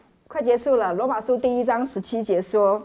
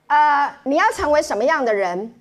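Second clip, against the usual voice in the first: normal talking voice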